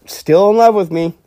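imitating John's smug certainty; smug mock boast. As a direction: crow it like John, pleased with himself. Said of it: "But John takes it as".

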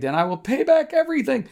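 high, pleading plea for mercy; a servant begging his master for mercy. A narrator's reading. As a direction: beg like a man about to lose everything. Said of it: "he begged".

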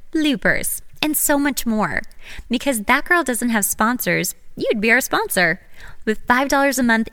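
upbeat, lively Patreon plug; podcast ad read. Acted. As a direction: pitch it to the listeners with energy. Reads podcast support promo.

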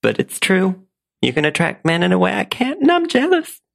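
high belle, reluctant then blurting; Blanche Devereaux. Acted. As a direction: confess jealousy at a pitch. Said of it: "In the role of Blanche Devereaux".

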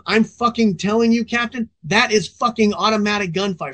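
half-acted shout at the captain; insisting rookie, lightly voiced yell; mild mock yell.

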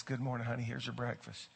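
a hint of a doting wife; soft sweet wife. Preacher voicing the wife serving breakfast, soft and sweet.

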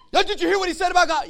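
high, very loud indignant shout; outraged David. Acted. David to his brothers about the giant, outraged shout. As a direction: shout it in disbelief at the insult.

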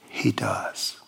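breathy whispered assurance. Real, not acted. Preacher assures the congregation of God's love, whispered. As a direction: whisper it like a secret truth. Hushed assurance after sermon climax.

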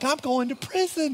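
high-pitched mock dread; retold panic. Pastor retelling his fear of prison, mock panicked. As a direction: blurt it like a comically panicked storyteller. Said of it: "riding down the road freaking out".

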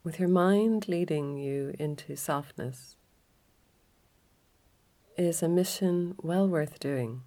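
calm yoga nidra guide, leaving space after each phrase; guided relaxation. Acted.

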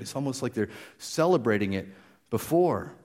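ordinary talk

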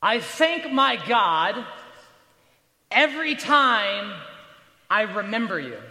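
loud, slow, measured proclamation; emphatic scripture reading. Acted. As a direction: proclaim it phrase by phrase, with pauses. First reading of Paul's verse aloud.

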